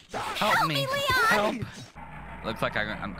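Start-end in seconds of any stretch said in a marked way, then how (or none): 0.1-1.6 very high, tiny mock plea for help; squeaky cry for help. Stuck in the garbage, calling upward in a high squeaky plea. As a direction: squeak it out like a trapped toy.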